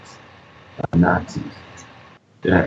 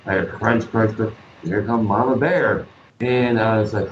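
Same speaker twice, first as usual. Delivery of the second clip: ordinary delivery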